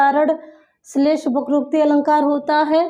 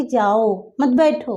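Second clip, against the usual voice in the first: जाओ leaned on and drawn out, then मत बैठो; slow, weighted जाओ before मत बैठो; stress on जाओ; 2 semitones lower, slower than usual. Shown as a demonstration. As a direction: hit जाओ, pause, then मत बैठो as one unit. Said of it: "जोर दिया जा रहा है".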